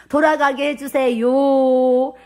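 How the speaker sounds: slow, drawn-out plea with long final vowel; retold pleading prayer. Preacher re-enacts her plea to God, drawn-out pleading. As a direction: stretch out the prayer's last words. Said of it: "이렇게 기도를 했다니까요".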